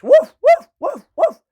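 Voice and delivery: high yapping woofs; dog barking. A narrator's reading. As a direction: bark out each woof like a small dog. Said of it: "And the dog barked".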